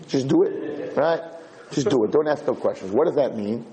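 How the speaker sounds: ordinary talk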